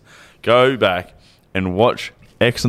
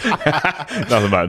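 laughing; 8 semitones higher, much slower than usual. Real, not acted. Laughing, coy denial.

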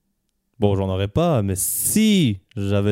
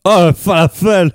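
mock exclaim; 8 semitones higher, 7 dB louder than usual. Mock radio hosts joking on name.

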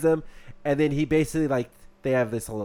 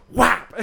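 a higher, louder mock gunshot "whap"; gunshot noise; 10 semitones higher, 7 dB louder than usual. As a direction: slap out the sound of the shot. Gunshot in the retold story.

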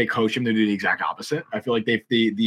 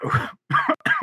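squeaky high giggle; high-pitched laugh. Real, not acted. Laughing at fetish joke.

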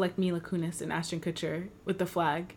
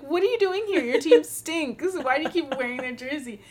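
mock heckle; 7 semitones higher than usual. Heckling the front-row Marlins fan, high and mocking. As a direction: tease him from the stands.

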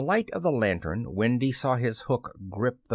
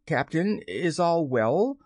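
higher, slow, tentative question; hesitant rising question; timid; 6 semitones higher than usual. A narrator's reading. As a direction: ask it carefully, pitched up, a touch timid. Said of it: "they asked timidly".

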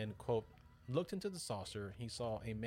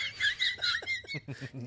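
loud laugh; 9 dB louder than usual. Real, not acted. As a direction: burst of laughter. Laughs at Italian remark.

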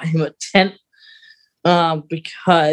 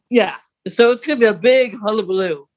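ordinary talk, nothing marked

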